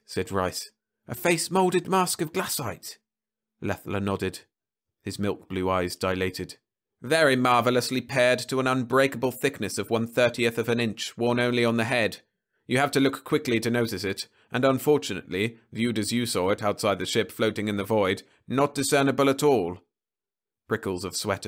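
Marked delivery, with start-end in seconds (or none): 1.1-3.0 higher, knowing crewman; a hint of grim recognition; Rice, realising. Rice identifying the mask, lightly voiced. 7.0-19.8 louder, higher triumphant Venusian; triumphant alien. Acted. Leffler revealing his trick to the crew, triumphant.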